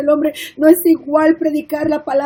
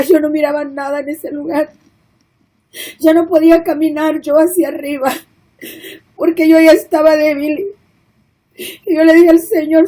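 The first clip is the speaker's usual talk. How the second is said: normal talking voice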